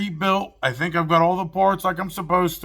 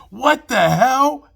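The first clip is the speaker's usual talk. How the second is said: shocked outburst; 7 semitones higher, 4 dB louder, much slower than usual. Retold or imitated. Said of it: "And then he's like".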